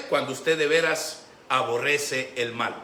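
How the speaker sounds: normal talking voice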